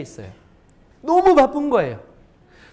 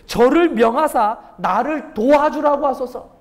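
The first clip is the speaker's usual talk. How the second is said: a touch of indignant complaint, slow; Martha's complaint; 5 semitones higher, 4 dB louder than usual. Acted. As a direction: mildly voice Martha's grievance. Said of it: "예수께 나아가 가로되".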